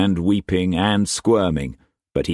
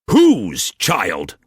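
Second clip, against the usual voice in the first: higher, louder raging master's voice; furious master; 5 semitones higher, 5 dB louder than usual. A narrator's reading. Struan raging at Mei Mei before whipping her, furious shout. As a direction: bark orders like an enraged lord.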